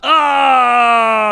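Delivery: shouted high oh of surprise; loud surprised oh. Real, not acted. Friend hearing the news, a loud startled oh. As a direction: blurt out a startled oh.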